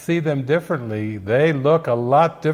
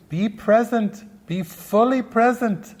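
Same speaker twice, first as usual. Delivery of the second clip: higher, light teacherly voice; a hint of a gentle guru, pitched up; Eckhart Tolle; 7 semitones higher than usual. Voicing Eckhart in your head, higher and light. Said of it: "And Eckert's in there too".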